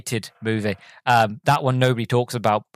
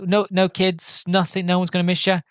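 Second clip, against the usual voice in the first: higher, lightly acted checking; probing question; 6 semitones higher than usual. Driver checking nobody would miss him, lightly acted probing. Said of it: "then he's like".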